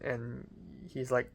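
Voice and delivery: usual speaking voice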